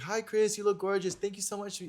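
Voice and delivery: bright high-pitched fangirl hello; high excited greeting. Retold or imitated. Gushing to Kris Jenner at the premiere, high-pitched greeting.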